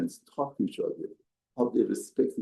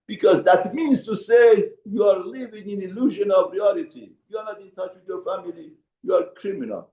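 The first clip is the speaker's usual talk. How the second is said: rising preacher's rebuke, pitched up; preaching rebuke; 9 semitones higher, 3 dB louder than usual. Acted. Rabbi warning a complacent congregant, forceful preaching. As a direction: press the warning home like a sermon.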